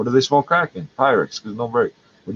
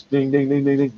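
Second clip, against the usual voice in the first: sing-song imitated beeps; register dings; 2 semitones higher than usual. Vlogger mimics the cash register beeping, playful.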